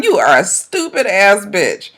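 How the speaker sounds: ordinary talk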